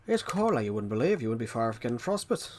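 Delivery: put-on strong, lilting Irish accent. Retelling an interviewed Irishman on the cold, Irish accent. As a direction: mimic the Irishman with swooping tune. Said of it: "But the Irishman".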